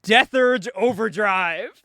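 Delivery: slow, loud, hammed-up announcement; grandiose name reveal. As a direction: draw out the code name with mock grandeur. Retold grand self-chosen code name.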